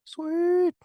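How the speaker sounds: an excited squeal of approval; thrilled host reaction; delighted. Real, not acted. Host reacts to heartfelt answer.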